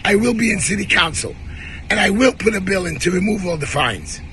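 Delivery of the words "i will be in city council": ordinary delivery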